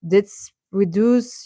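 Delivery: usual speaking voice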